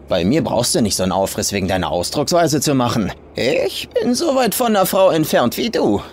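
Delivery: put-on high, fast, coarse old woman's voice; old farm woman ribbing the hitchhiker, high and fast; rough old farm woman. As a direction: play her as a crude, teasing farmer.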